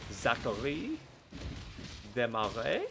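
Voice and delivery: name said the French way; French accent. Pretend. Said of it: "I have been doing French on, uh, a Duolingo".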